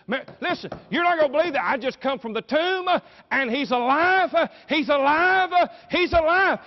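high, breathless-with-news shouting; excited announcement. Acted. Mary Magdalene brings news from the tomb, excited shout. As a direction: burst out with it, loud and climbing. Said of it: "the excitement in her voice".

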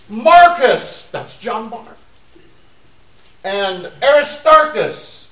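loud, high-pitched pulpit delivery; emphatic preaching. Acted. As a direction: proclaim each name to the congregation. Preaches the list of names.

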